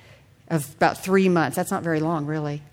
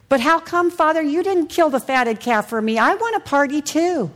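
put-on much higher, complaining like a sulky child; whiny older son; 7 semitones higher than usual. Pastor voicing the older son who feels overlooked, whiny and high. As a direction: plead like a jealous son left out of the party. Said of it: "what does the father say to the older son".

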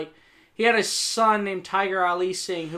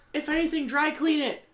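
exasperated scolding; 8 semitones higher than usual. Retold or imitated. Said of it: "I was like".

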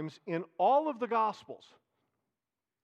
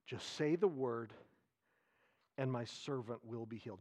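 quiet, reverent echo of the plea; soft reverent repeat; 7 semitones lower, 8 dB quieter than usual. Acted. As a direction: repeat it softly, letting it sink in. Repeats the centurion's words softly.

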